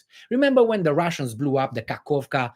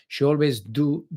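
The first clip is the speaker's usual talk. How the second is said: normal talking voice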